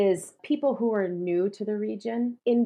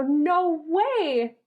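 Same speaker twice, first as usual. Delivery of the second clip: surprised no way; 9 semitones higher, 4 dB louder than usual. Retold or imitated. Retold surprise at meeting.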